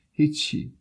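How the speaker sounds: put-on high little voice of a tearful boy. A narrator's reading. Crying boy brushing off a stranger's question, tearful high voice. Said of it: "صدای گریهٔ پسرک بلند شد".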